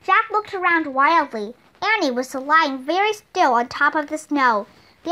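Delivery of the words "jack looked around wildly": ordinary delivery, nothing marked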